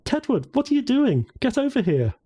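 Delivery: yelling. A narrator's reading. Woman calls him over from across the street, yelling. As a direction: call out high across a street. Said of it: "found herself yelling at him".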